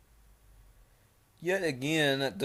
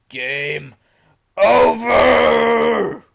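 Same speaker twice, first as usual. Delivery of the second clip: full-force monster yell, much higher and louder; huge villainous shout building to a scream; top-of-the-lungs yell; 10 semitones higher, 22 dB louder, much slower than usual. Acted. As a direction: scream it as the raging Iron Dogfish. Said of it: "yelled at top, uh, his lungs".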